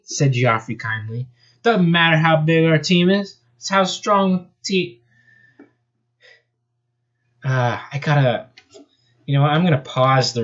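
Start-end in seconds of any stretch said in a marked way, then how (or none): 1.6-5.0 put-on Australian accent. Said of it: "He spoke with an Australian accent".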